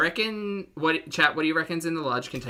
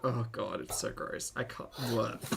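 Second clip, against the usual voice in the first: disgusted; 3 semitones lower, 8 dB quieter, slower than usual. Real, not acted. Grossed out by fork.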